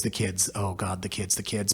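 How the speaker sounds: low, quiet aside; hushed mock despair about the kids; mock lament. Mock lament about kids' damage.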